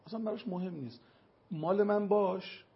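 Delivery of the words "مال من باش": loud, slow possessive demand; mock a selfish man claiming his wife, drawn out; loud and slow, "mine, be mine"; selfish husband voice. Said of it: "مردهای خودخواه اینجوریان".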